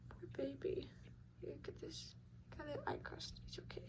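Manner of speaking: soft unvoiced whisper to a kitten; hushed whisper over a sleepy kitten. Real, not acted. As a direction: breathe it gently so the kitten stays calm. Cooing softly over kittens.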